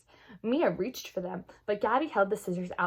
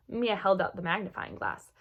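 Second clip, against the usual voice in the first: plain reading voice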